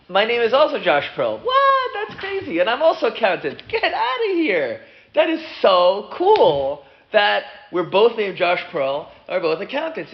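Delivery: very high, gushing disbelief; amazed excitement. Acted. Two namesake accountants meet, thrilled amazement. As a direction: squeal it at the coincidence, stretching key words.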